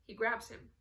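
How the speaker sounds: plain reading voice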